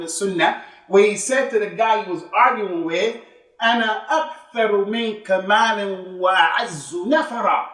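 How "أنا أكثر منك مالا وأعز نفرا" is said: a quick recited Arabic quote; Quranic recitation. Retold or imitated. Quoting Quran verse in Arabic.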